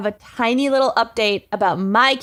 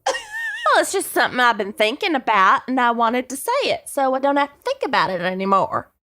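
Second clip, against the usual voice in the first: Texan church lady; 2 semitones higher than usual. Acted. Candy explaining her proposal to Alan, Texan church lady accent. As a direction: play a Texas church lady making her case. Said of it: "Texan church lady murderer accent".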